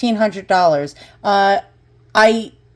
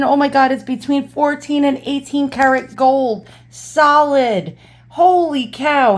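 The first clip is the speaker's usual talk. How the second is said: higher, thrilled gasp at real gold; excited disbelief; 6 semitones higher than usual. Real, not acted. Finding real gold piece.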